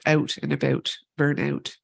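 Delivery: light Canadian "oot and aboot" vowels; Canadian accent. Shown as a demonstration. Moderator jokes about her own accent, light Canadian accent. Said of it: "That's my Canadian accent".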